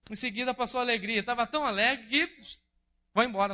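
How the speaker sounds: hurried joyful telling of Joy passing by; excited. A narrator's reading. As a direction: rush through it, bubbling with joy. Narrating Joy rushing past.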